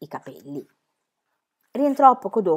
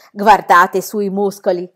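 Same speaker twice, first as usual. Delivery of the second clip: louder, slow foreign showman exclaiming; exclaiming ringmaster; 8 dB louder, slower than usual. A narrator's reading. Circus director shows off the strongman's muscles, exclaiming. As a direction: show off the muscles proudly. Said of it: "esclamò il direttore del circo".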